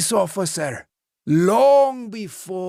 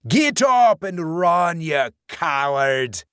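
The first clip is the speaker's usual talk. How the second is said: slow, louder, higher sneering taunt; hissing taunt; 7 semitones higher, 5 dB louder, slower than usual. A narrator's reading. Dead man taunts the fallen Lance, hissing louder. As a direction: spit it at him like a cruel ghost. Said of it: "Clancy hissed in his ear".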